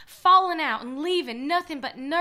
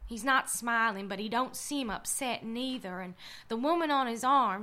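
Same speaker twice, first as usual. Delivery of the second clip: ordinary talk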